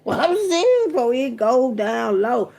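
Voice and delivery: high, loud burst of laughter through the words; laughing out loud at her own line; laughing hard. Real, not acted. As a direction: crack up while insisting you're serious. Laughs after "Bitch, gon' go.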